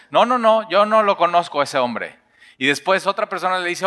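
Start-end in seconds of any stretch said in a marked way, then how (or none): 0.1-2.2 higher and louder, scrambling to deny; panicked denial. Acted. Peter denying he knows Jesus, panicked and loud. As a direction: deny it fast like you're caught.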